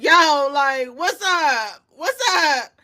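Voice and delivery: high, loud taunt; taunting player. Retold or imitated. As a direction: egg him on loudly. Voicing Wemby taunting Draymond.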